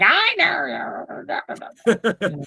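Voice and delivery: high, eerie voice popping out of nowhere; creepy witch voice. Retold or imitated. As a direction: sneak up with a spooky "I know you". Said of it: "she's like".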